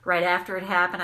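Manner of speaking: usual speaking voice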